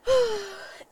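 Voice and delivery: real audible sigh mid-sentence. Real, not acted. Speaker pausing before naming an important person, sighing. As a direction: let out a long sigh.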